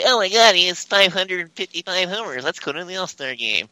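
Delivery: high, gushing clueless fan. Retold or imitated. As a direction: gush like a fan who knows nothing. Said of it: "I'd be like".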